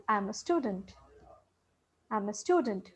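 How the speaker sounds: STUDENT dropping softly at the end; falling tone. Shown as a demonstration. Teacher giving an example statement, falling tone. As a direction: say it as a soft statement, pitch down on "student". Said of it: "falling tone is what we generally use in the statements".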